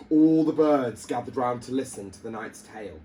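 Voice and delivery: plain reading voice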